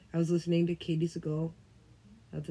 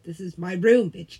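louder, bossy mock claim; mock yell; 8 dB louder than usual. Telling a friend off, mock yell. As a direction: stake your claim loudly.

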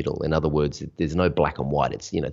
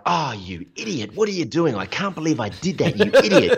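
higher, hammed-up scolding of yourself; mock self-scolding; 10 semitones higher, 4 dB louder than usual. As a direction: berate yourself in a raised comic voice. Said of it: "everyone else on set is like".

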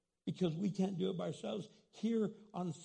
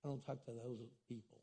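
low, much softer, dismissive aside; snobbish mock voice; 7 semitones lower, 11 dB quieter, faster than usual. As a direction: sniff at them like a snob.

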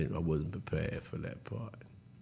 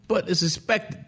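usual speaking voice

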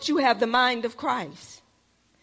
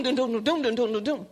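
imitated bass thump. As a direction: mimic the thumping bass from a passing car.

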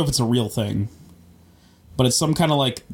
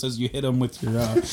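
normal talking voice

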